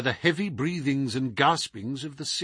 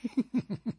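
short sly snigger; 10 semitones higher, 6 dB quieter than usual. A narrator's reading. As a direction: snicker under the breath. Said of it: "Our visitor sniggered".

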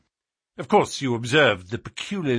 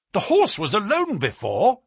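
higher-pitched, surprised exclamation; excited cry; 9 semitones higher, 4 dB louder than usual. A narrator's reading. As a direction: cry it out as a sudden discovery. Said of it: "I cried".

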